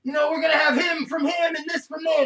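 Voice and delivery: loud, high, pumped-up eighties TV hype; hyped announcer. Retold or imitated. Retelling an eighties-style episode, a loud hyped announcer. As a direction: call it like a cheesy ski-movie host.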